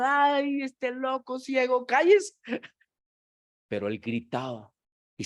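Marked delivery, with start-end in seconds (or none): 0.0-2.3 high, loud scolding hush at "cállese"; exasperated crowd shout. Acted. Crowd shouting at the blind beggar, exasperated scolding. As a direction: shut up that crazy blind man.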